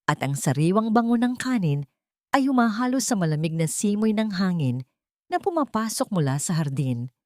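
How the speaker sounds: ordinary delivery, nothing marked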